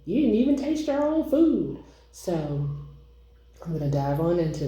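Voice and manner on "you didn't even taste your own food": mock viewer complaint. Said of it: "I don't want you guys to be like".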